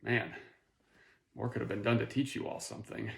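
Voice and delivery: soft understated muttering to oneself; mock rueful musing. Teacher looking at the failed test, softly rueful. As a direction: murmur it quickly, as mild regret.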